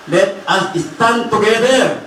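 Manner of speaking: rally the congregation to stand; rallying call. Real, not acted. (mabagal at madiing panawagan)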